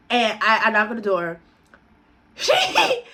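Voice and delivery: ordinary talk, nothing marked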